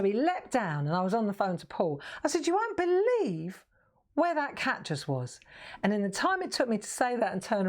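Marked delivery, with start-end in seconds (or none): 2.5-5.4 high, amazed telling; excited disbelief. Retold or imitated. On the phone to Paul, high amazed voice. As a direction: gasp it out, can't believe the cat.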